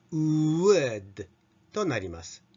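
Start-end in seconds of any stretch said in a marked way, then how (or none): 0.1-1.3 "wood" from a tight long w into an open vowel; joined two vowels. Shown as a demonstration. Teacher joining both sounds, drawn-out rounded-to-open "wood". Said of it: "連続すると".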